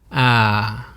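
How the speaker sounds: "Ah" dropping on a loosening throat; falling tone. Shown as a demonstration. Teacher loosening the throat, "Ah" falling. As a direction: open up and let "Ah" slide down. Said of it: "loosening my throat".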